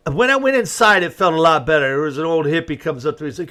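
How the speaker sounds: ordinary talk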